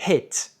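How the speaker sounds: English "hit", brief and open, not "heet"; short English I. Shown as a demonstration. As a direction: hit with a short lax vowel close to French É. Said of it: "le I court en anglais ressemble plutôt au E français".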